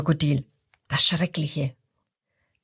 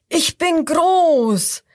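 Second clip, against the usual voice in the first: higher, louder, slow proud shout; crocodile roar; 8 semitones higher, 4 dB louder, much slower than usual. A narrator's reading. First crocodile shouts at the hyena, loud and slow. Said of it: "Das zweite ruft".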